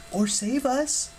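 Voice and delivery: high-pitched, hopeful suggestion. A narrator's reading. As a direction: offer a brighter possibility, voice lifted. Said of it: "Piper said hopefully".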